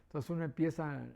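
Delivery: normal talking voice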